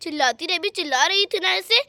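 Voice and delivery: taunting villain with smug laughs; mock the helpless girl, laughing; gloating thief. Thief to the gagged girl nobody can hear, gloating.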